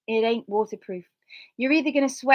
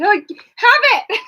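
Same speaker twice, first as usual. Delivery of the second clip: laughing offer; 13 semitones higher, 11 dB louder, much slower than usual. Retold or imitated. Giving the mat away, laughing.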